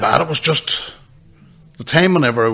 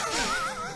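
high squeak of laughter; 11 dB quieter than usual. Real, not acted. Laughing at own story.

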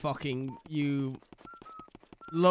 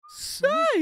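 put-on much higher, sharp old man's "Say!"; Herbert's call. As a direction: call out like a creepy old neighbor. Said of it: "I can't fucking".

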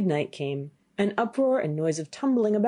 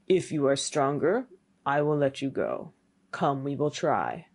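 low, measured challenge; menacing old man; 3 semitones lower than usual. Acted. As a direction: challenge him in a lowered voice.

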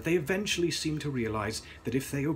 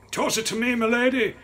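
strained, high, loud croak of a man in pain; croaking injured man; 13 semitones higher, 9 dB louder than usual. A narrator's reading. Coachman urgently asks.

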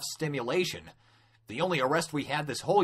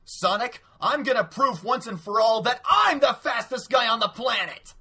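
high, forceful rival's vow; impassioned, pitched-up declaration; boastful challenge; 8 semitones higher, 4 dB louder than usual. Acted. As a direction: throw down the challenge with full bravado. Acted boastful challenge to Sonic.

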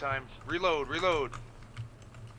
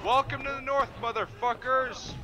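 battle cry; 10 semitones higher, slower than usual. Acted. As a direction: roar it at the enemy. Charging into enemy.

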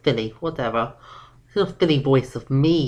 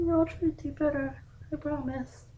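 contrite filly; 10 semitones higher, 8 dB quieter than usual. Acted. Daughter promising to improve.

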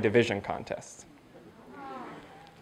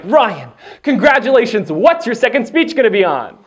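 higher-pitched excited congratulation; acted bubbly enthusiasm; gushing district governor; 6 semitones higher, 3 dB louder than usual. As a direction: imitate an eager official praising the winner.

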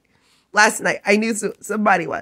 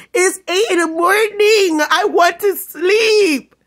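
exasperated yell; 8 semitones higher than usual. Real, not acted. Woken early, furious at housemates.

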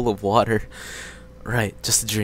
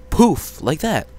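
playful poof; 5 semitones higher, 6 dB louder, slower than usual. Acted. Mimics water turning muddy.

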